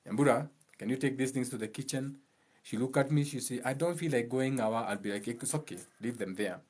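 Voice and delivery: quiet easy talk with the daughter; softly retold exchange. Father asking daughter to carry things, gently. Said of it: "I never tell my daughter".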